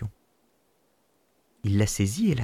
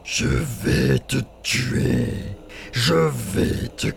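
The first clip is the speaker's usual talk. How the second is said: very slow, louder monster threat; menacing giant spirit; 4 dB louder, much slower than usual. Acted. Spirit threatening to kill the boy, very slow and menacing. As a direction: savour every word of the threat.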